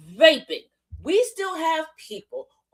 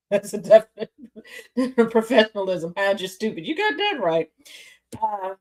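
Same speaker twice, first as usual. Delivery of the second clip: ordinary talk, nothing marked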